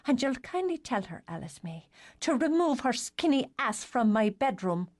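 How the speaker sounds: cold formal order to the sister; a lightly voiced haughty sister; stiff and formal. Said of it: "stiff and formal voice".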